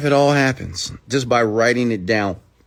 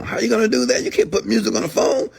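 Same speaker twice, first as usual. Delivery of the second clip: mock doubter; 12 semitones higher than usual. Doubter scoffing at putting music on phones.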